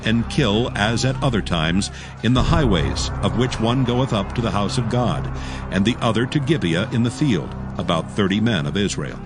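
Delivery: ordinary delivery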